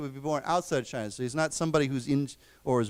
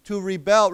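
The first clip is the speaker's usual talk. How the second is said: "rebel" as a verb, louder on BEL; stress on second syllable; 4 semitones higher, 7 dB louder than usual. Shown as a demonstration. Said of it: "we pronounce it differently".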